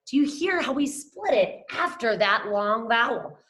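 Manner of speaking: ordinary talk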